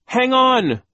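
high loud shout; urgent cry. A narrator's reading. Klaus calling to his trapped friend, loud urgent cry. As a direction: shout encouragement while pulling hard. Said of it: "Klaus cried".

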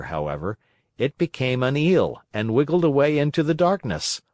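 ordinary delivery

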